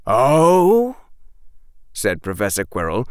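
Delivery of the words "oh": long, rising, loud oh; lazily amused rising query; casual and relaxed. Acted. Professor Quirrell hearing Draco's protest, casual. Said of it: "in his casual and relaxed manner".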